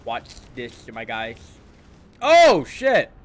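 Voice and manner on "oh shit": loud, high startled outburst; real alarm. Real, not acted. Player startled while riding in the galaxy, loud alarm. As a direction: yelp it in sudden alarm.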